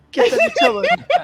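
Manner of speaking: high, loud hype cheer; hyped shout. Real, not acted.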